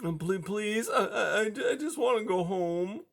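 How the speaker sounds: high, stammering little whimper; whimpery high mouse voice. A narrator's reading. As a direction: plead like a scared mouse. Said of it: "Blinker whimpered".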